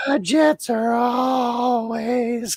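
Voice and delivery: high, very slow put-on voice; imitating Brady. Brady's chat reply to Zach, imitated high and slow. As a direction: drag it out in a mock Brady voice. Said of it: "and then".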